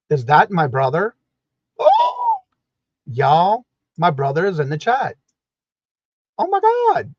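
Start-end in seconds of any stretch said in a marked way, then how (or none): 1.8-2.4 high, loud "oh!" of real surprise; surprised squeal. Real, not acted. Streamer spots brother in the chat, surprised squeal. 6.4-7.1 high, loud astonished exclamation; real surprise. Real, not acted. Streamer astonished that brother is watching, surprised exclamation.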